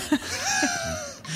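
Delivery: squealing laugh; high burst of laughter. Real, not acted. Laughing at own Australian slang.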